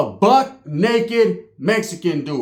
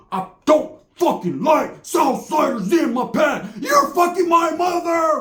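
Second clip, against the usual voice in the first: high, slow yelled rage; raging shout; 8 semitones higher, slower than usual. Retold or imitated. Cousin screaming at the paisa during beating. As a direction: scream it while stomping him. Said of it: "cousin yelling while stomping".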